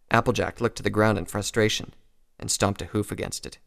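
ordinary delivery, nothing marked

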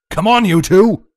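loud, high rallying shout. A narrator's reading. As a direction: shout to companions while giving chase. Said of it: "Vlad shouted as he chased".

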